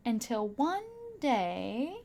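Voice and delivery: usual speaking voice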